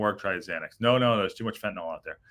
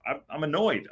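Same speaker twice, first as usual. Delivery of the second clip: ordinary delivery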